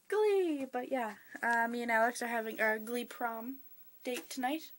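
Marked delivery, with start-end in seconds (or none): 0.1-0.7 high, loud burst of excitement; excited squeal. Retold or imitated. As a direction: shout it out thrilled. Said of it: "I'm kinda pumped 'cause I'm like".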